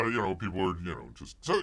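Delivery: usual speaking voice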